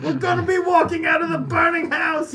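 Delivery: excited high yell; shouting. Acted. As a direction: yell it out in glee. Player shouting in character.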